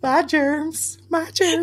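high, squeaky movie-quote voice; Scary Movie quote. Retold or imitated. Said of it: "anything anybody remembers from Scary Movie".

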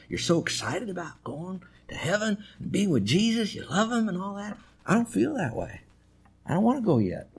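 soft, high-pitched confession; shy student. Retold or imitated. Shy student confessing to his professor, soft and high. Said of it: "one of those kids that was really very shy".

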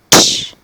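short loud "tsh" crash; crash sound effect. Acted. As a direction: spit a crash noise. Chair breaks into pieces.

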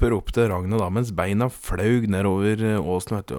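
ordinary delivery, nothing marked

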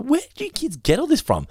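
high-pitched, disbelieving question; incredulous retort. Retold or imitated. Dad recounting his reaction to kids' overflowing buckets, incredulous.

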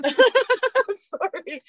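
slow, high, through laughter; laughing. Real, not acted. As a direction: apologize while cracking up. Laughing apology.

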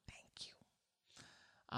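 hushed, voiceless thank-you; whispered mom thanks. Acted. Driver turned into a mom thanking another car, whispering. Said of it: "I turn into a mom".

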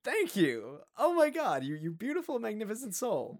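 high, loud mock gushing; gushing thanks. Thanking the older woman for her cake, gushing high and loud. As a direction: overdo the gratitude, gushing.

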